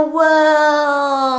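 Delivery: World swooping down fast and loud like a roller coaster loop. Shown as a demonstration. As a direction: drop World down as if riding the loop. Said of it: "That's how you pronounce it".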